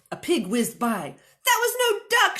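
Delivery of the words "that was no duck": high, loud yell; shouted. Acted. Moo shouts back at Minnie amid flying pigs. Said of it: "shouted Moo".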